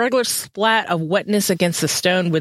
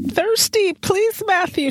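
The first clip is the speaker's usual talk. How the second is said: put-on much higher, small childlike begging voice; childlike plea; slower than usual. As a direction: beg like a helpless little child. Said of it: "a childlike being".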